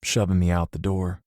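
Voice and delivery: plain reading voice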